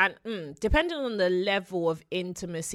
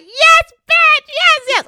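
very high, loud triumphant cheer; triumphant yell; 13 dB louder, much slower than usual. Retold or imitated. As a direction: shout it like you scored a goal. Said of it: "I was like".

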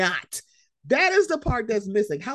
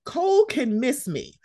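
ordinary talk, nothing marked

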